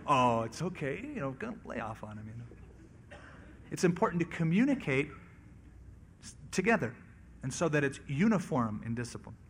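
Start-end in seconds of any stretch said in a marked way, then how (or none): 0.1-3.2 acted lenient father, voice dropping away; soft, low, easygoing let-off; softer dad. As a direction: play a dad quietly giving in. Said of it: "I know Dad's a little softer".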